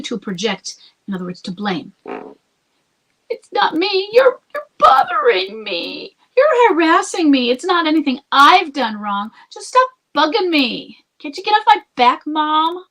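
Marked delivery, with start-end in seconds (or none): 3.3-12.8 put-on high, loud, crying teen protest; whining teen. Husband mocked as a teen crying at his mom, high wailing whine. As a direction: wail it like a sulky kid yelling at mom.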